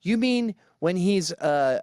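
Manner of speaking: normal talking voice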